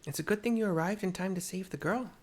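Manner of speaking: voiced game character. Rescuer telling of saving the girl, lightly voiced. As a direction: play the rescuer, a touch brisk.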